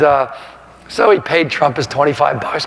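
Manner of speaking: normal talking voice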